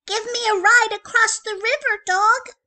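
high, loud little pup voice; cheeky puppy voice. Acted. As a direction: play the bossy little pup. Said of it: "said the gingerbread pup".

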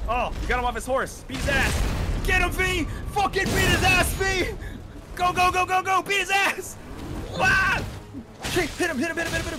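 shouting high and loud encouragement; cheering on a fight. Real, not acted. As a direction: yell him on through the fight. Cheering teammate against boss.

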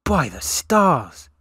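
slow, loud, high cry of wonder; awed exclamation. Acted. Gaz reaching the gleaming temple, awed loud cry. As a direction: let the awe burst out. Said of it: "Gaz skidded to a stop beside Parker and exclaimed".

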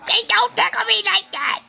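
put-on high squeaky baby voice; funny baby voice. Dad voicing his baby in a funny voice. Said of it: "that funny voice".

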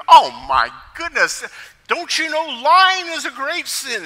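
high, loud mock outrage; shocked preachy pastor. Pastor reacts to kids' lying contest, mock-shocked preaching. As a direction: scold them like an aghast preacher. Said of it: "he breaks into a sermon".

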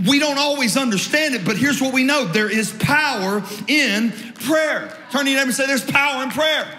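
high, forceful pulpit declaration; rousing call to the congregation; emphatic preaching. Real, not acted. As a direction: drive the point home, stretching the key words.

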